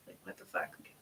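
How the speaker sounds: unvoiced self-scolding whisper; whispered curse. Real, not acted. Reader to viewers, whispering at her own forgetfulness. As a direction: breathe it out at yourself. Scolding herself over blonde moment.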